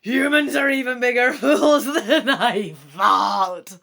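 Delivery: loud dragon voice breaking into a cackle; evil chortle. Acted. Dragon laughing at the foolish human, loud evil chortle. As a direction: gloat with an evil laugh through the words. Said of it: "The dragon chortles evilly".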